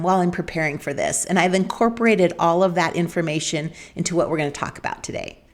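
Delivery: ordinary talk, nothing marked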